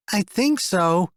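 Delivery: put-on high, slow child voice; a kid unsure; boy answering his mother; unsure little boy. A narrator's reading.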